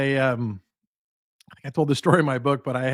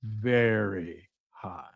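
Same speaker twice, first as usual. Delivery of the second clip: lower, softer and very slow; hushed, slow emphasis; 6 semitones lower, 7 dB quieter, much slower than usual. Retold or imitated. Company president leaning in to stress the score, hushed and very slow. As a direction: lean in and let it land quietly. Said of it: "he leaned forward and he goes".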